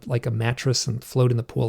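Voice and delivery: ordinary delivery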